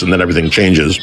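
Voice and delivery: ordinary delivery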